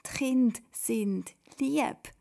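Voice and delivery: put-on high, insistent child; loud at first, then slowly model the sentence; called-out correction. A narrator's reading. Simon correcting Toda, high and insistent. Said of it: "ruft der Simon".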